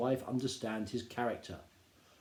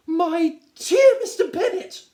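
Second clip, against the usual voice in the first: much higher, louder, slow and drawn out; shrill-voiced Mrs Bennet; 14 dB louder, slower than usual. Wife calling to her husband, high and gushing. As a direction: gush it like a fussy excited wife. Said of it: "said his lady".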